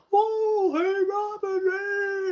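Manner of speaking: high, drawn-out holler; loud mock greeting in a high voice; imitated calling out. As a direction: hail a friend from afar, stretched out. Justin calls out on seeing her.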